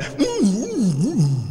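closed-mouth mm sounds; muzzled lion hum. Retold or imitated. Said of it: "I think they went".

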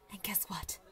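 gasp, then whisper it like a juicy secret; breathless hushed tease; gasping conspiratorial whisper; excited whisper. Acted. Gasping secret news to friend.

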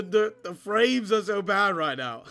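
ordinary delivery

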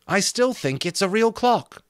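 high-pitched storybook woman; lightly acted high voice; Molly's character voice. A narrator's reading.

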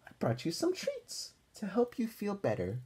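a gentle granny bringing treats; grandma voice. Acted. Grandma's kind voice to Arthur.

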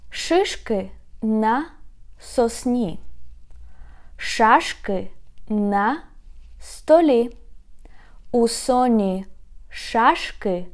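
Шишки на сосні read slowly, stressing each sh; slow deliberate pauses between words; slow careful reading. Shown as a demonstration. Teacher reads the second tongue twister slowly for learners. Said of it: "Sha and s".

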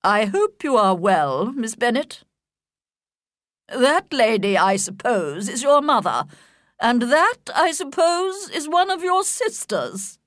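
a stiff, cold grand lady looking down on them; stiff haughty lady. A narrator's reading. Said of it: "said very stiffly".

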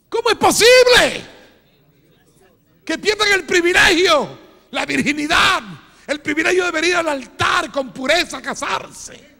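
loud, high fiery preacher cry; preaching at full force. Real, not acted. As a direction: thunder it at the congregation. Predicación a gritos. (predicación a todo pulmón, en voz alta y aguda)

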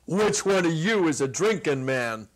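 loud, teasing big-man voice; amused giant. Acted. Ha Ha teasing the two visitors through laughter, loud and amused. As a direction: tease them with a booming grin.

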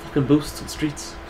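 slow put-on catchphrase voice; slow, drawn-out mimicry; Ogren. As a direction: drag it out like an impression on request. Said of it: "Do your Ogren".

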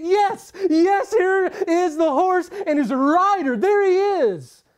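loud, high, eager shout of welcome; eager welcoming cry. Acted. Believer greeting the returning Christ with an eager, loud cry. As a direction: cry out with joy at the sight. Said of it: "And your response to his coming is".

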